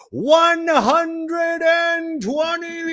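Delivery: very high, drawn-out number; hammed-up announcement. Real, not acted. Host announcing the episode number to viewers, hammed-up high drawn-out call. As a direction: stretch it out high like a ring announcer.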